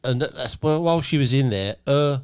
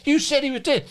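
high-pitched mock disbelief; comic, incredulous imitation; retold outraged shock. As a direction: act out a crowd turning accusing. Housemates' shocked outcry, retold.

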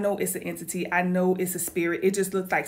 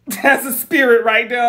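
laughing through the quote, much louder; 5 semitones higher, 12 dB louder than usual. Retold or imitated. Quoting her partner about the spirit, laughing loudly. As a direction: burst out laughing mid-quote.

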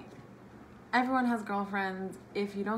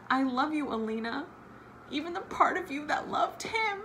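high, choked reading of the love quote; tearful reading; 7 semitones higher than usual. Acted. Vlogger reading Mal's jail-cell line, high and choking up. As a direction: read it as if about to cry.

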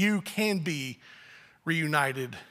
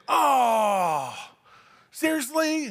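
drawn-out groan, then high disbelief; exasperated kid; 4 semitones higher, much slower than usual. Acted. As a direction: groan like a kid told to wait. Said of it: "they were like".

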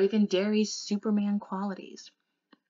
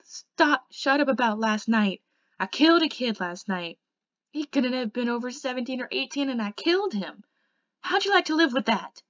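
loud, anguished rebuke, higher pitched; crying outburst; 6 semitones higher, 9 dB louder than usual. A narrator's reading. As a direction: lash out loudly in distress, building to the last question. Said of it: "He was crying".

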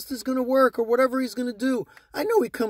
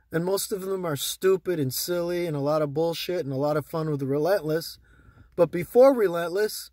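ordinary talk

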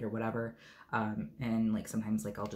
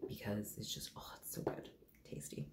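soft, breathy whisper of pleasure; whispered delight; 11 dB quieter than usual. Real, not acted. Whispering to viewers over the scent she loves. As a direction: whisper it like a guilty treat.

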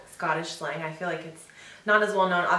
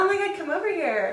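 put-on high, laughing American girl parody; mock American accent; 9 semitones higher than usual. Echoing her friend's parody, hammed-up American accent. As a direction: play up your American accent, amused.

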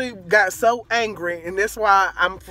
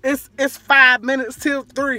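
exasperated, higher-pitched retelling of an argument; mad; 7 semitones higher, 5 dB louder than usual. Retold or imitated. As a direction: protest in annoyance, pitch up. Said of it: "I'm mad. I'm like".

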